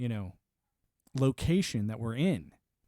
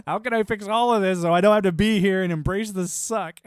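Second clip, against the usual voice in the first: louder, laughing mock gripe; mock exasperation; 5 semitones higher, 8 dB louder than usual. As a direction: gripe it out loud like a fed-up soldier. Voicing soldier's frustration.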